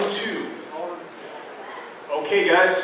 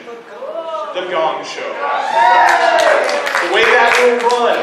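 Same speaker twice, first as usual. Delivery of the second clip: dramatic announcement; 5 semitones higher, 5 dB louder, much slower than usual. Acted. Announcing the Gong Show dramatically.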